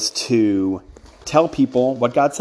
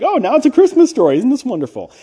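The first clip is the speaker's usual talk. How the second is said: much higher, gushing mock delight; mock gushing listener; 12 semitones higher, 4 dB louder than usual. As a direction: squeal it like a delighted churchgoer. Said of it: "some of you who are familiar with this section will be like".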